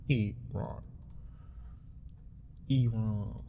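Iran drawn out as "ee-RAHN"; native-style pronunciation. Shown as a demonstration. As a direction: say Iran to rhyme with Iman, "ee-rahn". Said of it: "Like Iman".

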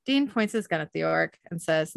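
ordinary delivery, nothing marked

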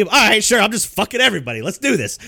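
sarcastic retort to gossiping coworkers, mock-exasperated; sarcastic outburst. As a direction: throw up your hands in mock surrender.

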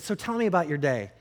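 normal talking voice

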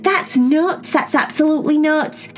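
put-on excited kid asking her sisters questions; Cherry voice.

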